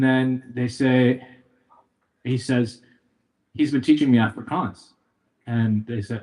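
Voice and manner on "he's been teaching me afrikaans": ordinary talk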